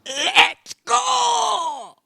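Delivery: very high, drawn-out catchphrase yell; mock DJ yell. Co-host copying the DJ's catchphrase, high drawn-out yell. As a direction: stretch it into a high-pitched holler.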